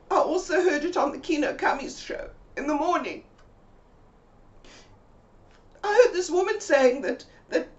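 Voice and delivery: high, loud, breathless worried account; high panicked Mabel relaying the news; emotional friend. Retold or imitated.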